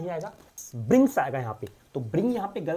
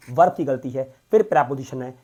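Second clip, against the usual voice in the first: normal talking voice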